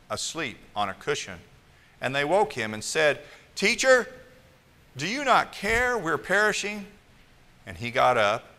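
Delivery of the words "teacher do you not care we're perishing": panicked plea. Acted. Disciples cry out in storm.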